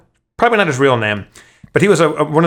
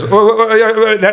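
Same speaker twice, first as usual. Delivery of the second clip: higher, louder mock fluster; flustered apologetic backpedalling; 8 semitones higher, 4 dB louder than usual. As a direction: imitate someone stammering after stepping in it. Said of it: "Now he feels like he stepped in it. Now he's all apologetic".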